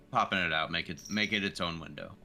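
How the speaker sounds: usual speaking voice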